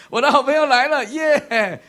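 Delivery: loud, high, whooping welcome; excited cheer. Retold or imitated. 对孩子兴奋欢呼，好朋友来了. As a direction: cheer like a friend just arrived. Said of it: "统一称呼".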